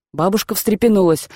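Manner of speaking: ordinary delivery, nothing marked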